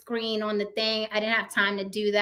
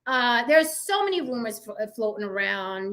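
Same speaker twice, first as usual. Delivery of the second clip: ordinary talk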